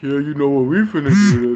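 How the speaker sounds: high, loud mocking ad guy; mock smooth guy. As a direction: ham up the ad guy's line. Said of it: "He's like".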